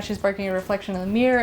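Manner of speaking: usual speaking voice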